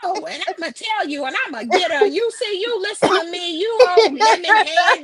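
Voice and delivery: high, loud teasing man voice; zesty hammed-up impression; imitating a man. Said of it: "You sound just like him".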